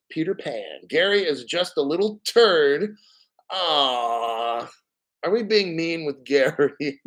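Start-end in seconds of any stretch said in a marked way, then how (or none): 3.5-4.7 mock pity.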